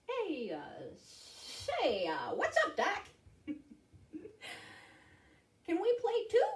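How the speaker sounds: rabbit voice rising high; hesitant start, then high perky rabbit; cartoon rabbit. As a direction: play a wisecracking cartoon bunny. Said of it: "Rabbit hopped onto the field".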